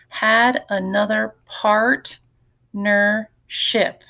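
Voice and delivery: slow, stretched read of "partnership", each syllable set apart; slow teacher demo, word broken into syllables. Shown as a demonstration. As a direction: read it slowly so the class can count the syllables. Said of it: "So I see three syllables here".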